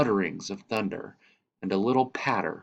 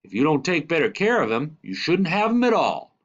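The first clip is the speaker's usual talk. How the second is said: fast, louder scolding stranger; terrible man scolding; 8 semitones higher, 6 dB louder than usual. Acted. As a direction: tell the boy off sharply. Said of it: "said the terrible man".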